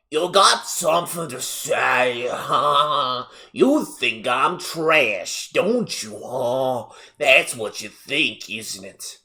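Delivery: slow, confrontational Southern mom; hammed-up Southern accent, picking a fight; thick Southern accent. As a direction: drag out each taunt in a thick Southern twang. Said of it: "extremely thick, high-pitched Southern accent".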